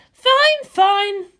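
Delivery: a tense commander on the eve of battle; Misato's voice. Acted. Misato brooding to Ritsuko about tomorrow, high and loud.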